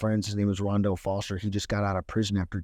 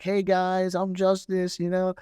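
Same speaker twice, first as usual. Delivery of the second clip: higher-pitched upbeat self-introduction, retold; super positive; 7 semitones higher, slower than usual. Greeting a class unprepared, super positive. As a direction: act out an over-eager hello. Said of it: "so I started off, like, super, like, positive".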